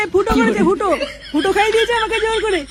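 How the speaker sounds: put-on laughing child. Boy blames friend, laughing.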